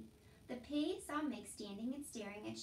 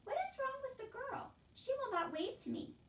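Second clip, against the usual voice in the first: very high little princess voice; complaining princess; 14 semitones higher than usual. A narrator's reading. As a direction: complain in a high royal whine. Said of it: "called the princess".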